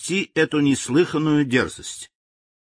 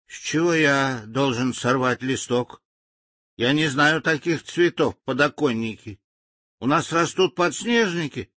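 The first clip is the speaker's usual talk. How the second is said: baffled native; 6 semitones higher than usual. Acted.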